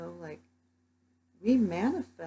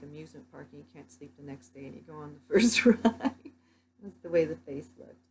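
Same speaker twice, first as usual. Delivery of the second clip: normal talking voice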